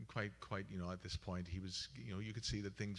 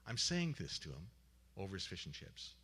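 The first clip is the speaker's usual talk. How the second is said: ordinary talk, nothing marked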